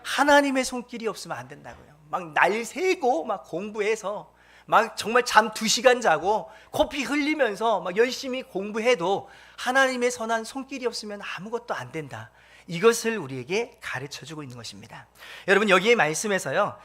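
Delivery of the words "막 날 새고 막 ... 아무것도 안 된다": ordinary delivery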